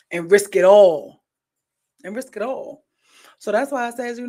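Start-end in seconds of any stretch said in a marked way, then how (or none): 0.1-1.2 loud, slow punchline: and risk it all; emphatic warning. Retold or imitated. Friend warning about cheating, loud and slow. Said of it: "she be like". 2.0-2.8 softer repeat of and risk it all; quiet echo. Retold or imitated. Friend's warning echoed softly to herself. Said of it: "she be like".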